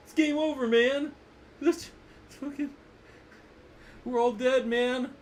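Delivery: Bill Paxton impression. Teacher hams up a movie marine panicking for students, high frantic voice. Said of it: "I was doing Bill Paxton".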